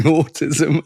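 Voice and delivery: usual speaking voice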